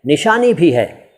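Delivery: normal talking voice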